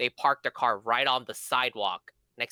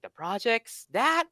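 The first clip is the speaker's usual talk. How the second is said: high, incredulous mock question; scoffing; 11 semitones higher, slower than usual. Rapper laughing off a warning about the projects, high scoffing disbelief. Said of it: "and he was laughing".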